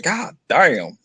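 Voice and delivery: mock-astonished drawl; retold amazement. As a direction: stretch it out in stunned disbelief. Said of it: "I was like".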